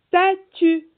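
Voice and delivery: long hissed S before the rest of "statue", loud and high; stretched initial S. Shown as a demonstration. Teacher asks children about S in statue, stretched initial S. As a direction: hold the first S of "statue", then finish firmly. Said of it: "Est-ce qu'on entend le S dans statue?".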